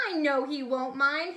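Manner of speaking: usual speaking voice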